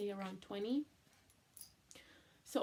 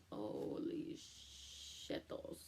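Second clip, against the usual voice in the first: stunned whisper; 8 dB quieter, much slower than usual. Retold or imitated. Shock at positive pregnancy test.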